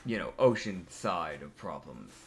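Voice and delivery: low, soft, hesitant advice; tactful recommendation. Acted. Merc Seeker advising on ocean problems, low hesitant suggestion. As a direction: suggest it carefully, with long pauses. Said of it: "he would just go".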